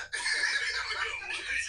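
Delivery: short high burst of laughter; real high laugh. Real, not acted. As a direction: crack up. Laughs at the story.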